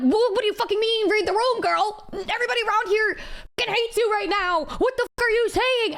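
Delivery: high, incredulous comeback; retold argument, fired up without shouting; indignant retort. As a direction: throw it back at them, outraged. Her retort in retold quarrel.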